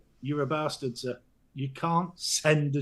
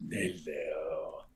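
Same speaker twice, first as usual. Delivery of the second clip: deep put-on hello; 13 semitones lower, 11 dB quieter than usual.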